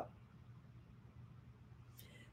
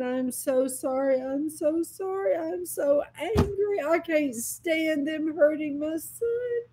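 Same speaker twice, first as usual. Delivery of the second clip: high tearful plea, building higher; retold crying; 8 semitones higher, 3 dB quieter than usual. Mother apologizes to God in her dream, crying. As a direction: voice climbing into a pleading, weepy apology. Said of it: "I cried and cried and cried".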